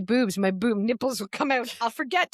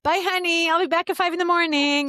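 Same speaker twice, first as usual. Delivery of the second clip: playful mock goodbye; 7 semitones higher, 5 dB louder than usual. Pretend leaving for a rave.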